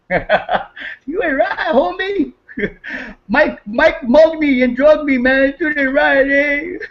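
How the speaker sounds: high, cracking up through the words; laughing mock complaint. Real, not acted. Teasing his friend Mike over a mug, laughing. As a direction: tease him while laughing helplessly.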